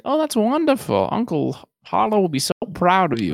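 high, gushing proud-mom voice; suddenly sweet and proud, a mother showing off her son; mock doting mother. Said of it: "berating him to being like".

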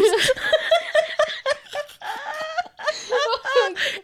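high shrieking burst of laughter. Real, not acted. Bursts out laughing at own story.

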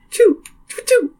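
tiny high chirpy sneezes; little birdie sneeze. Retold or imitated. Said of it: "Like a little birdie".

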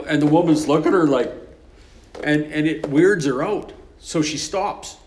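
ordinary talk, nothing marked

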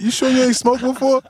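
high, disbelieving question; amazed teasing. Retold or imitated. Grandson teases grandma about her smoking, amazed. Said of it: "I'm like".

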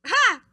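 high, sudden burst of a laugh; loud "ha". Real, not acted. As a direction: let out one loud, high "ha!". Reacts to next question card.

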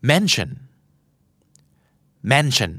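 mention with MEN punched and tion reduced to a light shun; stress on first syllable. Shown as a demonstration. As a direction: hit MEN, swallow the tion. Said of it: "ออกเสียงพยางค์เน้นก่อนหน้าชั่นเสมอ". (เน้นพยางค์ MEN ส่วน tion เบาๆ)

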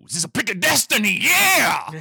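fast, high rocker's yell; hammed-up loud rock-star cry. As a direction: build to a huge triumphant shout.

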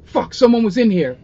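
loud, high alarmed curse; startled NPC. Acted. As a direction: blurt it out, caught off guard.

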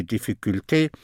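"difficulté" with final "té" sounded as "tay"; French -té ending. Shown as a demonstration. Teacher showing the French ending of English -ty words, "difficulté" ending in "tay". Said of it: "all words in T are T in French".

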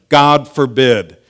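loud, slow "God forbid", falling at the end; emphatic. Acted. Preacher reading Paul's answer in King James, emphatic "God forbid". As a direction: hit "God forbid" hard, both words drawn out. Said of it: "it seems the most emphatic".

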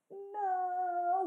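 a stunned, mock-wailed refusal; dismayed no. Girlfriend hearing he's joining the Air Force, dismayed. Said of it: "And I was like".

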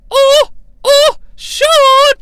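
very high, loud mock swoon; hammed-up drawn-out cries; Sherry Martel. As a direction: wail it high in a put-on voice. Imitates Sherry's song moan.

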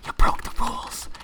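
harsh whispered accusation; ASMR gamer. Pretend. Host whispers rule-breaking complaint as ASMR gamer. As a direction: whisper the outrage. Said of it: "I could A S M R".

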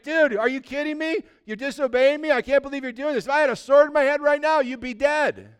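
high-pitched, worked-up comic rant; mock-indignant arguing. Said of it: "he gets in an argument with the donkey".

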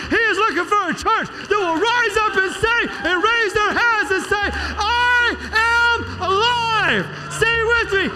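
full-force preaching. Real, not acted. Preacher leading the church to declare life, shouting.

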